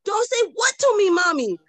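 put-on a little boy scolding his mother; indignant toddler. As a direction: son scolding mom in a high child voice. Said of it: "he'll say".

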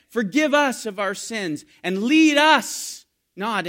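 loud, high pulpit preaching; fervent sermon voice; preaching at full force. Acted. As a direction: drive it home to the congregation. Preaching louder and higher.

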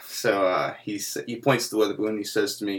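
ordinary delivery

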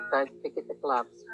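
ordinary talk, nothing marked

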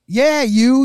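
loud, high call across a distance; retold calling out. Filmer to a cop charging at him, retold loud call. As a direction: call out the officer, imitating the filmer.

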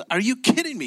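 high-pitched mock outrage; acted incredulity. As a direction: play the buyer in rising disbelief. Retold buyer repeats his disbelief.

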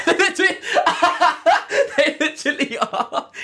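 high laughing through the words; giggling it out, can't keep it together. Real, not acted. Laughing as she agrees they only follow Komedo.

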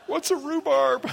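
put-on high, slow, laughing childish puzzlement; mock bewildered kid. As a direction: ask it like a baffled little kid, half laughing. Child puzzled by rhubarb pie.

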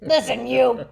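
a stern call, no menace; loud firm voice. Retold or imitated. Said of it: "your serious voice".

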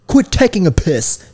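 high, put-on British rebuke; British accent. Shown as a demonstration. Host showing friend British talk, mock British accent. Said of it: "something a British person would say".

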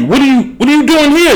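high-pitched, amazed questioning; starstruck disbelief. Acted. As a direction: act astonished at running into a celebrity. Acted amazement at meeting Tom Brady.

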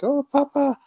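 a quick mock child calling Papa; child's voice.

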